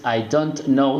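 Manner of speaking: full T in "don't", words kept apart; stiff, over-careful "I don't know"; wrong pronunciation. Shown as a demonstration. Teacher showing learners what not to say, over-enunciated "don't". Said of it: "no dices".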